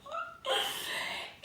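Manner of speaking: a high, breathless little laugh; high breathy giggle. Real, not acted. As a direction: giggle at yourself. Laughing at her own answer.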